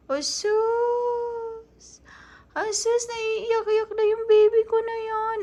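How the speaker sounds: high, drawn-out teasing coo. Real, not acted. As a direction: fuss over a tearful little one in a high voice. Mother coos over her tearful child.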